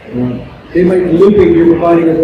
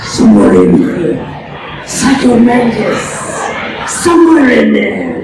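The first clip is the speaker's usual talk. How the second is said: Psycho Mantis; 7 semitones higher, slower than usual. Shown as a demonstration. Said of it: "I do recall him whispering".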